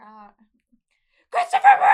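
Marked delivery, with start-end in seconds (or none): 1.3-1.9 full high-pitched shout; imitating a yell. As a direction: bellow the name like a fan. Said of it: "Or I'll hear".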